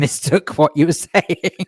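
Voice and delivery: real laughter breaking into the words; laughing. Real, not acted. Host owning up to mishearing the acronym, laughing. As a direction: crack up while finishing the sentence.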